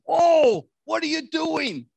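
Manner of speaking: high, loud, outraged protest; hammed-up appalled objection; indignant Peter. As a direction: burst in with disbelief, stretching the questions. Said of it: "Peter says".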